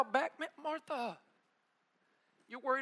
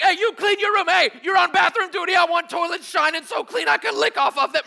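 shouted chores, much higher and louder; barking orders; 6 semitones higher, 13 dB louder than usual. Retold or imitated. Parent shouting chores at the kids before company. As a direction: yell orders at the kids to clean up.

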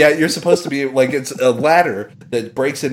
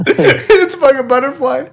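squeaking high with laughter; can barely get it out for laughing; laughing hard; 12 semitones higher, 4 dB louder than usual. Real, not acted. Friend losing it over the QVC butterfly blooper, laughing hard. Cracking up at the story.

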